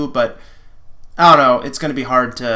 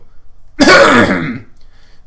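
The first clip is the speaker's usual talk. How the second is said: loud, high theatrical throat clear; 9 semitones higher, 7 dB louder than usual. Acted. Prince clearing his throat before speaking, hammed up. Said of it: "he cleared his throat before addressing you".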